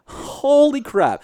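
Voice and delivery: drawn-out high gasp of disbelief; high and slow holy crap; stunned. Retold or imitated. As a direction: say it slowly, jaw dropped. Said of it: "and I was like".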